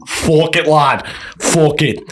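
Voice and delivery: put-on British accent. Podcaster clowning for the other hosts, put-on British accent. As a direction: toss it out in a mock British lad accent. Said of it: "that's Scottish".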